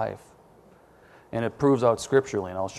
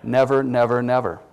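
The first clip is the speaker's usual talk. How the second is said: each "never" punched a little louder, then falling; emphatic repetition; 4 dB louder than usual. Real, not acted. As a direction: hammer home "never, never, never". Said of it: "How often does it disappoint?".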